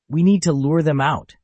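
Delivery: loud, eager call to action; determined. Acted. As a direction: push it with fired-up resolve. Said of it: "her eyes sparkling with determination".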